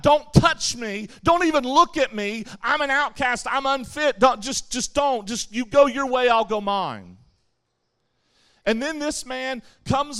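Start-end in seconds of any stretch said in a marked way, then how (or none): 0.0-7.2 raised, urgent voice warning people off; loud plea to be left alone; pleading outcast yell. Retold or imitated. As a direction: push everyone away, loudly. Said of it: "you had to yell out".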